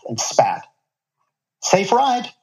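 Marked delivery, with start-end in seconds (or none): 1.6-2.3 bright, high friendly question; eager young driver asking; young guy asking. A narrator's reading. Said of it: "a voice asked".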